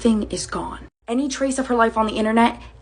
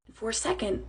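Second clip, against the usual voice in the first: ordinary delivery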